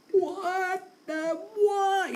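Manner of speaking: high, drawn-out, slow bewildered question; stunned disbelief. Retold or imitated. Disciples reacting to the storm stopping, slow and high in disbelief. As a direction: gape at the sudden calm.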